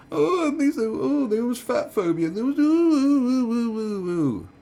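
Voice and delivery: very high, drawn-out mock crying; loud falsetto boo-hoo mockery; mock wailing. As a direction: wail like a hammed-up victim. Mocks her complaining about reactions.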